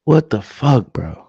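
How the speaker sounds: real disbelief at the fans' own team; exasperated disbelief. Real, not acted. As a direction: throw up your hands at the team.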